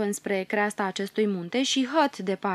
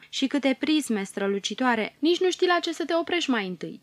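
rising to a high, fast, breathless rush of wonder; a girl bursting with delight at the crystals; gushing with wonder, speeding up; excited; 5 semitones higher than usual. Acted.

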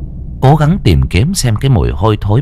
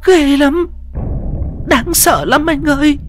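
much higher, fast, near-crying plea; tearful pleading wife; 4 dB quieter than usual. A narrator's reading. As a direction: beg to be taken outside, on the edge of tears. Wife warns husband of ghost. (giọng vợ van xin, mếu máo)

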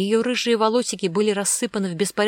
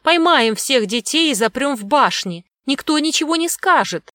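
high, eager servile voice; loud, high-pitched toady; bleating underling; 7 semitones higher, 5 dB louder than usual. A narrator's reading. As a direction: placate the master in a raised pitch. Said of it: "заблеял один из его подчинённых".

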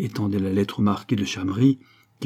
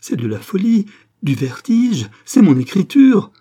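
frantic outburst, climbing higher and louder; panicked, escalating shock; distraught; 7 semitones higher, 8 dB louder than usual. A narrator's reading. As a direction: build from disbelief to a loud, high cry. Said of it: "dit-elle éperdue".

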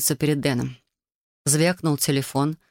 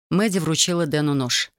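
ordinary delivery, nothing marked

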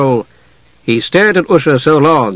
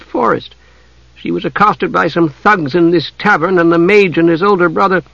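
put-on higher-pitched, lively girl storyteller; young Usha; 5 semitones higher than usual. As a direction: tell it as the young heroine, pitched up. Acts Usha telling her tale.

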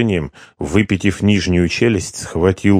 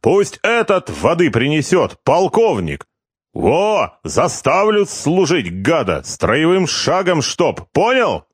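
high, slow-ish barked orders; drunk shouting orders; 9 semitones higher, slower than usual. Acted. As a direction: order him about like a sergeant.

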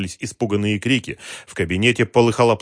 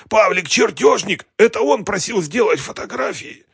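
choked rasp; 12 semitones higher, 4 dB louder than usual. A narrator's reading. The choked captive names who ordered photographs, strained and high. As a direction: squeeze it out high and loud through a gripped throat. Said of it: "прохрипел Вадим".

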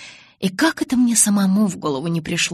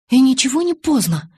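loud, higher angry retort; сердито; 8 semitones higher, 7 dB louder than usual. Acted. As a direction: snap back angrily. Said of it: "сердито возразила я". (сердитое возражение)

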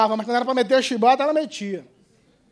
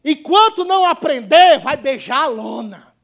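emphatic call; 2 semitones higher, 8 dB louder than usual. Real, not acted. First of two repeated emphatic lines.

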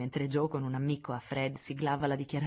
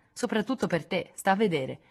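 usual speaking voice

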